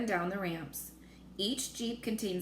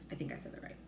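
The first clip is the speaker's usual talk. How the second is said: unvoiced whisper to yourself; whispered self-check; whispered aside; 3 semitones lower, 10 dB quieter, much faster than usual. Real, not acted. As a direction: check yourself under your breath. Reader's aside to listener.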